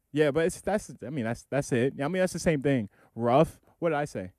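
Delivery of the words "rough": "Rough" like a dog's ruff, louder; "Rough" with a short uh, rising like a question. Retold or imitated. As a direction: imitate the odd roof as a punched "Rough". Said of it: "You said like a dog".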